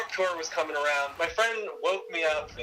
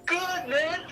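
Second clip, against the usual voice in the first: much higher, upbeat; pumped, far higher; high energy; 13 semitones higher than usual. Retold or imitated. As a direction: keep the hype going. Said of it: "you kinda have to match [name]'s energy".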